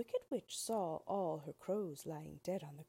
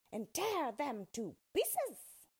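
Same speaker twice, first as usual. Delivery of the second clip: higher, louder, slower witch command; crying witch; 8 semitones higher, 4 dB louder, slower than usual. A narrator's reading. Wicked Witch sending her wolves, a high slow cry. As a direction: order the wolves out, high and drawn out. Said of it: "cried the witch".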